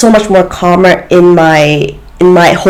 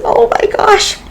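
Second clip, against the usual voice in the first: high, softer gasp of joyful awe; moved to tears; 5 dB quieter than usual. Real, not acted. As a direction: breathe it out, overwhelmed. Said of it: "I could have cried, like, tears of joy".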